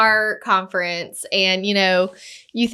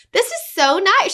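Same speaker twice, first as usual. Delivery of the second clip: pleased gushing; 8 semitones higher than usual. Retold or imitated. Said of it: "I'm like".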